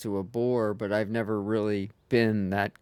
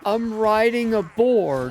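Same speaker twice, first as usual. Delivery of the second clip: high, loud gleeful shout; excited; 8 semitones higher, 6 dB louder than usual. Real, not acted. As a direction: shout it out with delight. Gleeful while riding a boar.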